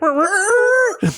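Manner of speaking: high, loud imitated record screech; DJ scratch noise. Retelling dad walking over, DJ screech noise. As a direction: imitate a DJ's sound effect. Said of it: "you could hear the D J".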